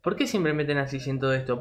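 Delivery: ordinary delivery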